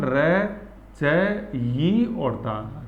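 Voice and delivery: slow, each syllable separate: र, च, यी, ता. Shown as a demonstration. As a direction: spell out र च यी ता slowly, pausing between. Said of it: "तो क्या लिखते हैं अपन?". (धीरे-धीरे हर अक्षर अलग करके)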